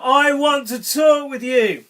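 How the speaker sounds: slow, high, loud summons; calling out. A narrator's reading. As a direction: call it out slowly as God in a children's story. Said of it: "God called one night".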